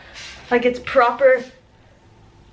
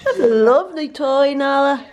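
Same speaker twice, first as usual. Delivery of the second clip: pet talk; 4 semitones higher than usual. Real, not acted. Talking to the dog.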